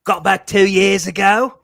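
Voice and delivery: loud, high, drawn-out mock Kiwi accent; hammed-up New Zealand twang, slowly; fake New Zealand accent. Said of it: "pretending that he has a New Zealand accent".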